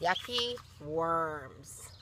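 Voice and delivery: plain reading voice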